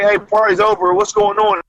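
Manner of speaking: imitating police. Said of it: "it's like".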